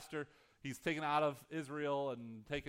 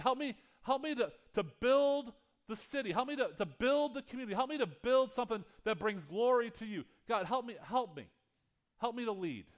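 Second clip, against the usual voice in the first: fervent prayer; 5 semitones higher, 3 dB louder than usual. Acted. Pastor praying aloud before his church, fervent and pleading. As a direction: lift each plea up to God with rising urgency. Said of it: "And my prayer is".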